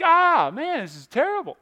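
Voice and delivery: mock frustration. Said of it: "I would get so frustrated".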